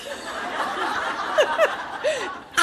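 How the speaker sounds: real laughter, high; loud high laugh. Real, not acted. Big laugh after yes.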